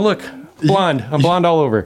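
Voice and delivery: high, eager show-and-tell; mock excited look-at-this. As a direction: point it out like a proud kid. Showing off armpit hair to stranger.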